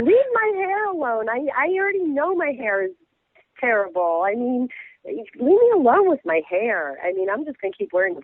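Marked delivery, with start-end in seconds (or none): 0.0-7.0 high-pitched plea to be left alone; mock protest. Real, not acted. Guest tells critics to leave her hair alone, high mock protest.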